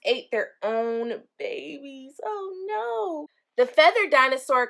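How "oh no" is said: high, soft mock horror; mock dismay. Teacher reacting for kids to cannibal dinosaurs, high mock dismay. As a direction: gasp it out like a shocked storyteller. Dinosaurs ate their own babies.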